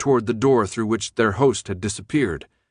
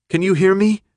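high, loud call to someone unresponsive; urgent yell; 9 semitones higher, 6 dB louder than usual. A narrator's reading. Brenda bending over Thomas, yelling urgently. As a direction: shout it high, trying to get through. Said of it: "Brenda yelled".

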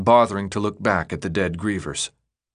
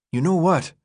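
slightly higher, lightly voiced battered fighter; Minho's voice; 7 semitones higher than usual. A narrator's reading. Minho tells Thomas how many died, voiced lightly and grim. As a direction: report the losses grim and winded.

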